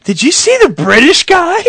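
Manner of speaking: high-pitched mocking sister; sister's voice. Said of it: "So my sister says".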